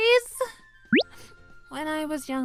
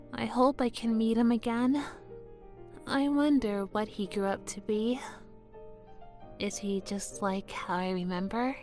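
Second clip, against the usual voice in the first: low, soft daydream; hushed, low inner thought; wistful musing; 6 semitones lower, 6 dB quieter than usual. Acted. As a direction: wonder to yourself tenderly. Wistful musing about first crush.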